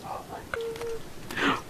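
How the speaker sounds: hushed, drawn-out gasp of alarm; scared whisper. Real, not acted. Player alone finding a skeleton, scared whisper. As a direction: whisper it slowly in fright.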